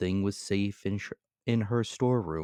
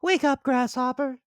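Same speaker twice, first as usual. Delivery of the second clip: ordinary delivery, nothing marked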